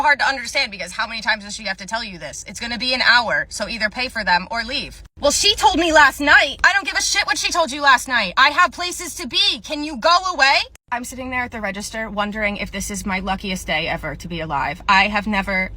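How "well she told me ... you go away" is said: Karens shouting. Acted.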